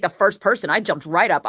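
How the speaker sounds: ordinary talk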